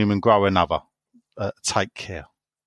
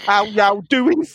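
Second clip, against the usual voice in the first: high, louder mock Birmingham accent; Brummie greeting; 9 semitones higher, 4 dB louder, slower than usual. Mocking the Brummie way of talking, mock Birmingham accent. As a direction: ham up a Brummie "how y'all doing". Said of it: "and they talk like that".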